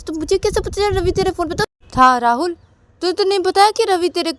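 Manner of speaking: high, loud demanding; angry questioning. Acted. Sister confronting brother, high loud anger. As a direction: press him hard, voice up.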